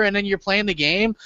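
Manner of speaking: ordinary delivery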